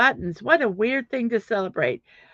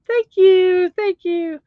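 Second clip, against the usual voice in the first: high, put-on thank-you to an audience; mock acceptance thanks; 9 semitones higher, 7 dB louder, slower than usual. Streamer congratulating herself, high mock-grateful voice. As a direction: take a playful bow in a high voice. Celebrating getting something right.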